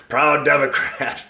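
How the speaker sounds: loud, high and drawn out; mock proud declaration. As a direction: proclaim it slowly like a slogan, amused. Mock-voicing a car's bumper sticker.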